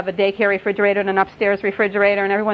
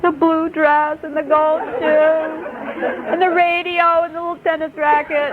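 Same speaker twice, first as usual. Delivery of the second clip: mock tearful little-girl voice, high and drawn out; imitating sobbing child; 8 semitones higher than usual. Upset girl lists her forgotten Barbie things, imitated sobbing. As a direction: wail each item slowly through pretend sobs. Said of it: "she just sobbed and told".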